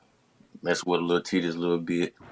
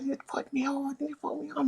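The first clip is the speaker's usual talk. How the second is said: high woman's voice; 10 dB quieter than usual. Retold or imitated. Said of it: "she like".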